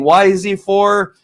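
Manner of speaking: ordinary talk, nothing marked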